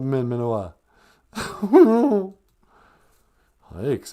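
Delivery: ordinary talk, nothing marked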